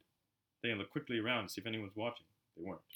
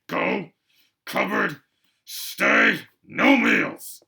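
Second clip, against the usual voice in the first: much higher, loud choppy furious barks; barely able to speak with rage; 9 semitones higher, 15 dB louder, much slower than usual. A narrator's reading. Uncle Vernon furiously sends Harry to the cupboard. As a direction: spit single words in fury. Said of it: "so angry he could hardly speak".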